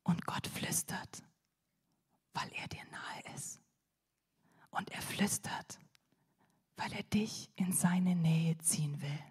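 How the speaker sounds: barely audible whisper, close to the mic; hushed, tender whisper as if to one listener. Shown as a demonstration. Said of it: "Warum flüstert Gott?".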